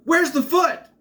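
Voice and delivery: high, loud yelled question; mock yell. Someone in the crowd yelling out, high and loud. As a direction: shout it up high like a panicked kid. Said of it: "someone yells out".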